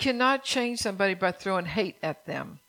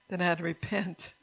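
ordinary talk, nothing marked

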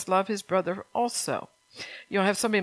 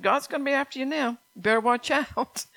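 laughing warning; 6 semitones higher than usual. Retold or imitated. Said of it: "I said".

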